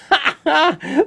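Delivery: high, real burst of laughter; delighted laugh. Real, not acted. Vlogger tickled by a viewer's gift, delighted laugh.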